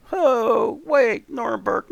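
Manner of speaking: high, loud sudden alarm; startled cry of alarm. Real, not acted. Sudden alarm at ally's danger.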